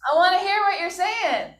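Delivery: high, exasperated "spit it out"; impatient student. Retold or imitated. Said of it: "you're like".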